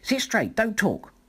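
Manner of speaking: teacher snapping orders at the class; stern teacher. A narrator's reading. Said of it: "telling the class".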